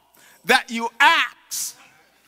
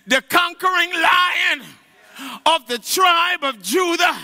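preaching at full force; 8 semitones higher, slower than usual. Acted. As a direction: shout it out to the congregation with conviction. Preacher shouting to congregation.

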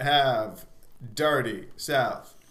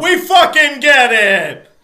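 exasperated shout; 11 semitones higher, 15 dB louder, much slower than usual. Real, not acted. Exasperated friend cuts off French.

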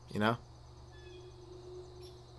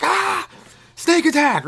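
sudden high yelp, much higher; mock scream; 6 dB louder, slower than usual. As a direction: shriek as the snake strikes. Snake bites the charmer.

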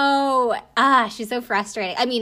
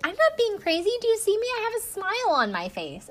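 mock innocent; 12 semitones higher than usual. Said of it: "And Lacey's like".

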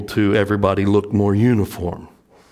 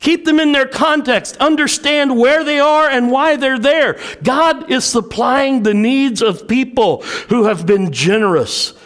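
full-force preaching; 5 dB louder than usual. Acted. Pastor preaching to the church about giving, full force. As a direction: preach it out to the congregation.

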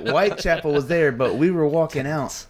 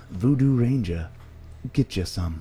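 ad tagline. Pretend. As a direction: close the ad with a hook. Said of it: "read it like a commercial".

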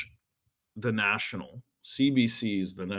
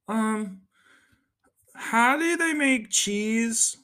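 put-on much higher, slow, innocent child voice; little kid; 12 semitones higher, much slower than usual. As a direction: stretch it out like a clueless kid. Kid asks random question.

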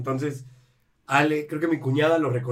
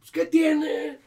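embarrassed shrug; 11 semitones higher, much slower than usual. Retold or imitated. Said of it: "mi suegro apenadísimo".

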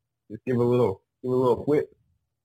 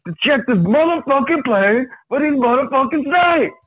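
Samuel L. Jackson impression; 11 semitones higher than usual. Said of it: "Samuel Jackson".

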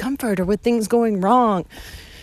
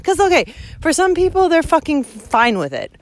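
normal talking voice

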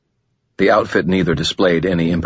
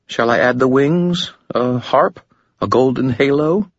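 lightly voiced woman, higher, smooth and composed; a hint of a calm, alluring woman, higher; Lashiel, gracious demon; 8 semitones higher than usual.

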